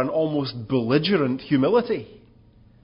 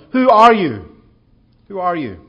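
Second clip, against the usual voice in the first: loud, insistent demand, higher; demanding question; 5 semitones higher, 10 dB louder than usual. Acted. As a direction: press him hard for an answer. Priests questioning John, loud demand.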